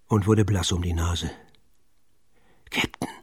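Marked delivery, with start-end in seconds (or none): 2.7-3.1 leise geflüsterte Anrede, kaum Stimme; geflüstert. A narrator's reading. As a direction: vorsichtig zum Kapitän flüstern. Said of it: "flüsterte er". (Pitt flüstert dem Kapitän ängstlich zu)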